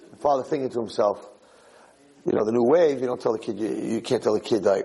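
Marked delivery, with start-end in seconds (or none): none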